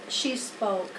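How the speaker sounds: usual speaking voice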